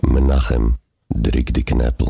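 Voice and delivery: a Yiddish-accented promo hollered to Menachem. Shown as a demonstration. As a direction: announce it like a Yiddish radio promo. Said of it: "בבקשה".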